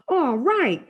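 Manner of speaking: high, loud cheer; excited shout. A narrator's reading. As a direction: shout it out in delight. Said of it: "Freak is shouting".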